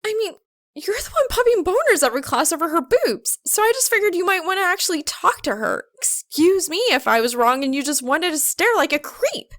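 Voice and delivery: put-on girl scolding Soul, fed up; angry outburst. A narrator's reading. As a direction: let it fly at him. Maka snapping at Soul.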